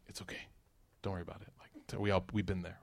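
soft, low-key sibling reassurance; quietly console, as an imitated sibling; hushed comforting. Siblings comforting after mom's outburst.

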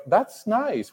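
high, soft, awkward compliment; polite fake approval. Weirded-out guests being polite about the wall, high and soft.

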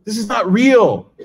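high, slow, drawn-out grumble; mock indignant complaint. Young pilgrims grumble about luxury at Hajj, slow mock indignation. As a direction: play the outraged pilgrim, hammed up. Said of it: "these guys are just like".

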